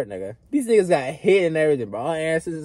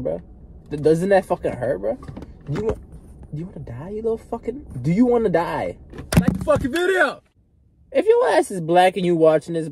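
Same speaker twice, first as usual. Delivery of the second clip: usual speaking voice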